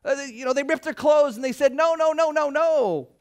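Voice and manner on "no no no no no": raised, higher refusal; hammed-up crowd protest; mock protest. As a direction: voice the panicked crowd saying no. People refusing the land.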